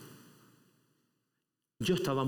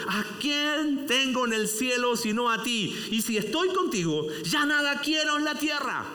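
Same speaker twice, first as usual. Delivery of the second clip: high, louder psalm proclamation; fervent scripture reading; 9 semitones higher, 3 dB louder than usual. Acted. Preacher reading Asaf's psalm to the church, fervent reading. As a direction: proclaim the verse with awe.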